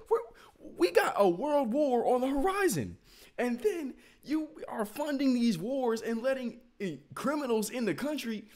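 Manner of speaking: high, loud incredulous outburst; outraged rant. Retold or imitated. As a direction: rant in loud disbelief, voice climbing. Outraged retelling of own reaction.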